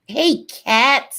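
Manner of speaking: high, loud, drawn-out hello; excited greeting. Real, not acted. Streamer greeting a friend in the chat, excited call. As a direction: call out to a friend you just spotted.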